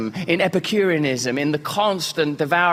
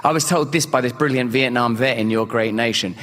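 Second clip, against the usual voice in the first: ordinary delivery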